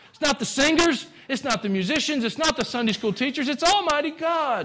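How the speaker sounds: high, ringing pulpit declaration; full-force preaching. Real, not acted. Preacher telling church who it's about, fervent preaching. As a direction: build the list to a high, ringing climax.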